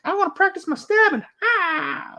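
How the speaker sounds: high, fast cartoon voice; Futurama character. Quoting the Futurama stabbing bit in a high, fast cartoon voice. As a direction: squeak it like a silly cartoon guy. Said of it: "reference from Futurama".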